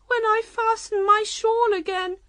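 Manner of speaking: unhappy. A narrator's reading. Queen expecting her shawl pin to prick, unhappy. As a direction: lament it in a high voice. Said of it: "the Queen said unhappily".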